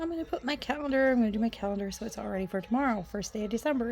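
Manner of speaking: fast, eager gabble; rushed self-quote. Retold or imitated. Quoting her own busy planning, fast. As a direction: rattle it off excitedly.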